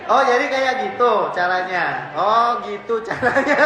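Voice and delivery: slow, amused laughing words. Real, not acted. Preacher laughing at the audience's answer, slow and amused. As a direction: chuckle through it.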